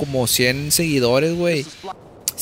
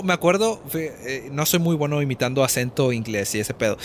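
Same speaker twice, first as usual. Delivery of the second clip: ordinary talk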